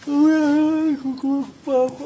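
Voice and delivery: ordinary delivery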